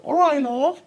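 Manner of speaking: a friendly Liverpool till lady greeting you; imitating the cashier. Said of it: "la primera vez que me dijo". (imitando a la cajera scouser, mucho más agudo)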